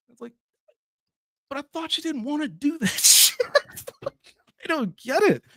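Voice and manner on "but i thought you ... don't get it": very high falsetto, hammed-up bawling; fake-crying squeal, dragged out at the end; mock whiny protest. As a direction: mimic a tearful, baffled complainer. Mocking baffled objectors, continued.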